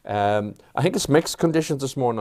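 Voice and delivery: ordinary delivery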